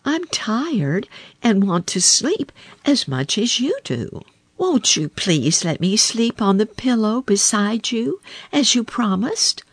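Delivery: high, wheedling frog; pleading frog. A narrator's reading. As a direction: beg sweetly for the pillow.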